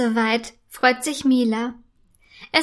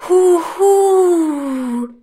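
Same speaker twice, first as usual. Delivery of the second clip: loud, high, long-drawn ghost howl; spooky mock-ghost wail; ghostly hooting; 5 semitones higher, 6 dB louder than usual. A narrator's reading. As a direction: hoot like a spook, stretching the last vowel. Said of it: "ein Geräusch hören".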